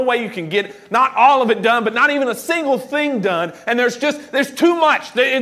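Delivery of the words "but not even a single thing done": ordinary talk, nothing marked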